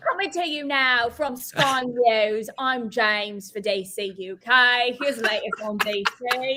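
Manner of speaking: put-on slow newsreader in an English accent. Co-host spoofing a UK news intro, English accent. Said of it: "when I pull out the English accent".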